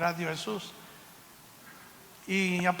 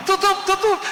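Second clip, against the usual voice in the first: imitated heartbeat; 10 semitones higher, 5 dB louder than usual. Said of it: "corazón latiendo".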